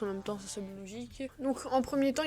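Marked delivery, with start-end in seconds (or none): none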